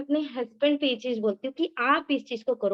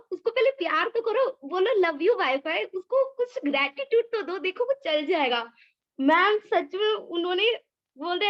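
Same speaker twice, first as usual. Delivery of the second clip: much higher, eager laughing retelling; excited; 5 semitones higher than usual. Real, not acted. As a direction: tell it high and animated, still laughing. हँसते हुए, बहुत ऊँची आवाज़ में. (ऊँची, उत्साहित आवाज़ में)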